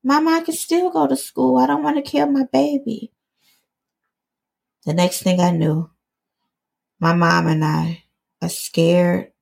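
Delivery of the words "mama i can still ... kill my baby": higher, pleading with mom; pleading cry. Retold or imitated. Daughter begging mom to keep baby, high pleading. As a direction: beg her not to make you do it. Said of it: "I cried".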